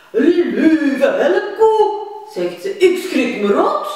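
high, loud startled laughing sheep. Acted. Sheep startled by the cow, high laughing fright. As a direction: gasp and laugh like a scared sheep. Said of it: "Het schaap schrikt erg".

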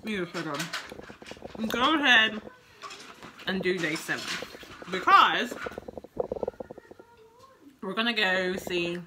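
talking through a mouthful; words round a bite of food; chewing while talking. Real, not acted. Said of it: "I'm chewing with my mouth open".

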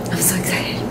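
excited whisper. Real, not acted. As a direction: breathe it out in a whisper. Whispered aside to viewers.

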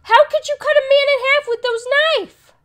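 high loud frantic yelling; frantic about the bloody house; panicked outcry. Real, not acted. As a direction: yell in rising panic. Panicked reaction to horror scene.

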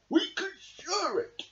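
slow, kindly high voice; calm the others with a smile; smiling. Acted. Badger settles the friends' dispute, slow and smiling. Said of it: "smiled Badger".

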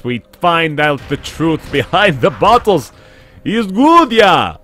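put-on Transylvanian Count accent; dramatic vampire Count voice. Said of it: "I adopted a Count voice".

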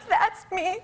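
high, pitiful whine, hammed up; mock tearful wail. Sobbing passenger recognizes her show, in a mock tearful wail. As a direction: whimper it in an exaggerated high voice. Sobbing retold, theme song plays.

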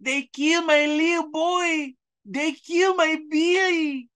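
high, slow, wailing fake Mexican accent; mocking the wailing Delavina in a silly Mexican accent. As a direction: ham up a sobbing woman in a Mexican accent. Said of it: "wailing and crying and screaming".

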